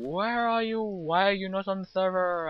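high-pitched, whiny imitation of a friend demanding answers; high mock complaint; mock nagging friend. Voices friend nagging about his absence.

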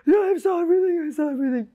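put-on high, shaken girlfriend voice; lightly voiced frightened girlfriend; girlfriend's voice. Girlfriend telling him what she saw, high and shaken. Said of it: "She's like".

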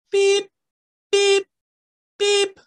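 high, loud machine beep. A narrator's reading. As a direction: beep like a snow plough warning. Machine noise in story.

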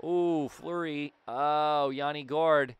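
usual speaking voice

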